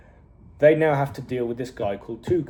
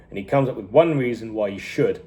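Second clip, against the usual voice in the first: ordinary delivery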